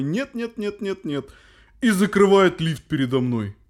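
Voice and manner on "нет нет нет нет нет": high, soft smug «Нет» repeated; singsong «Нет, нет» wagging a finger; teasing old man refusal. Retold or imitated. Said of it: "пальцем из стороны в сторону и такой".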